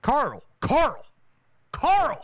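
shouting a name, rising; yelling for someone across the house; calling out. Real, not acted. Calling out for Carl.

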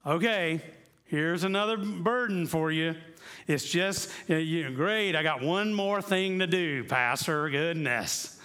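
hammed-up exasperated churchgoer; grumbling complaint. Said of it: "if you hear Jesus saying".

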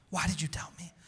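hushed whispered repeat of the question. Acted. Pastor voicing Jesus whispering the question to Peter. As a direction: breathe it out as a tender whisper.

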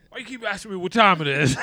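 loud, high mock complaint; mock exasperated yell. Playing the clock-wearing rapper, loud mock annoyance. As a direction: holler it like a fed-up hype man.

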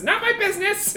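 high-pitched goblin bowing out; giving up. Acted. Goblin folding under questioning before he leaves, high-pitched surrender. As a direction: disown it all in a high little voice. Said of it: "throws up his hands and says".

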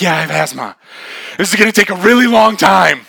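loud, high comic complaint; mock exasperation. Girl with inhaler to her companion, mock exasperated complaint.

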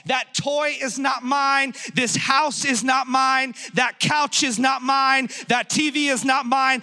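emphatic, insistent sermon delivery; full-force preaching. Real, not acted. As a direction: hammer each declaration home to the congregation.

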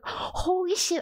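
high, mildly panicked retold gasp; freaking out. Actor retelling surprise callback, mock freaking out. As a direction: playfully act out freaking out. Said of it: "I'm, like, freaking out".

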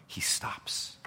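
hushed whisper; softly whispered pause. Real, not acted. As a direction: drop to a whisper for effect. Dramatic hushed moment.

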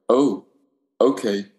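ordinary talk

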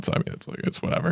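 breathy, trailing off under the breath; whispered. Real, not acted. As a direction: mutter it away like it doesn't matter. Shrugging off rejection.

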